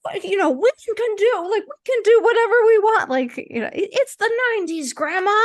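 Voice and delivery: put-on high, loud childlike protest; high-pitched mock kid outburst; kid's protest. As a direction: argue back at grandma as an indignant kid. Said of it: "And I'm like".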